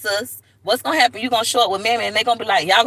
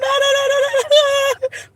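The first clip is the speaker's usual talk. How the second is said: high cackling laugh; 3 dB louder than usual. Real, not acted. Laugh after mock cry.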